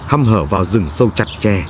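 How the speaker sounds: ordinary delivery, nothing marked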